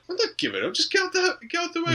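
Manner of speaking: high, comic scolding; mock indignant refusal. Auntie refuses the robber.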